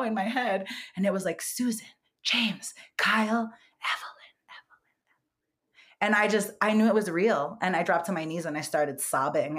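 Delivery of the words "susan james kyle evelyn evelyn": whispered names. Retold or imitated. Spirits naming themselves in his head, whispered. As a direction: let the names drift away to a whisper.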